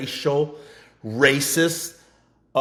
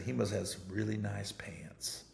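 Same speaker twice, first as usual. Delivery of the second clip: whisper; 8 semitones lower, 16 dB quieter than usual. Retold or imitated. [name]'s quoted deadpan answer.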